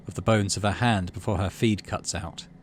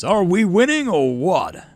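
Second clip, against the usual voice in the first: slow, loud, higher rallying call; booming crowd-pleaser; 9 semitones higher, 7 dB louder, slower than usual. Acted. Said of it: "His voice booms over the speakers".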